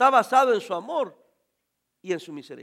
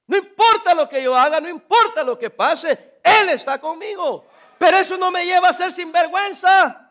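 high, loud, building joy; fervent preaching; 7 semitones higher, 7 dB louder than usual. Real, not acted. Preacher rejoicing to his congregation, loud and fervent. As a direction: let the joy spill over. Preacher exulting in God's presence.

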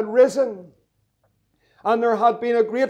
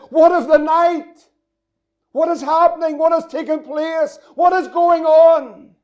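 desperate cry; 5 semitones higher, 4 dB louder than usual. Acted. Preacher voicing a distressed believer, a loud desperate shout.

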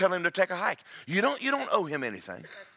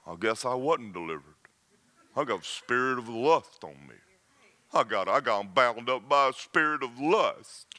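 low, mopey mock lament; lightly mocking a defeated believer; 7 semitones lower than usual. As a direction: whine like someone who gave up. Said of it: "you'll go".

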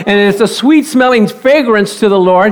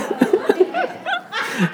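high delighted laugh; 12 semitones higher, 10 dB quieter than usual. Retold or imitated. Farmer's joy story.

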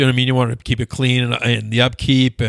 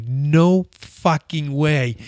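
very slow, emphatic refusal; drawn-out disbelief; much slower than usual. Retold or imitated. Gym veteran retells refusing Kobe his workout, drawn-out and incredulous. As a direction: stretch each word in mock disbelief.

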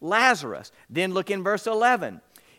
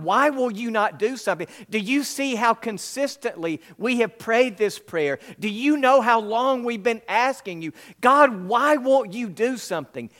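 anguished plea; 6 semitones higher, 5 dB louder than usual. Retold or imitated. Pleading prayer to God.

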